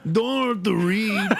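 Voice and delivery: Irish lilt with a laugh; Irish accent. Retold or imitated. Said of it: "Irish accent".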